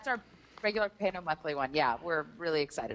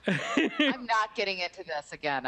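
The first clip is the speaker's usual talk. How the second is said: slow, drawn-out protest through laughter; amused, real laugh in the words; laughing refusal; slower than usual. Real, not acted. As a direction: laugh while refusing to take the bait. Laughing refusal of his teasing praise.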